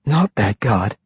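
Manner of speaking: faint, calm advice; barely able to speak; weak voice. A narrator's reading. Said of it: "Afa Frenfir advised".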